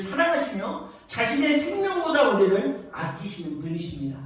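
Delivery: loud, high, swelling pulpit declamation; preaching at full force. Acted. Pastor proclaiming God's love to the congregation, full-force preaching.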